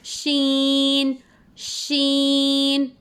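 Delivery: शीन drawn out long, tongue middle to mid palate; Arabic letter sheen. Shown as a demonstration. Teacher demonstrating शीन to her students, slow and drawn out. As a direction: stretch शीन slowly like a recitation drill. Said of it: "श पढ़ना है".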